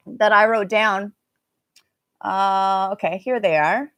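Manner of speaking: normal talking voice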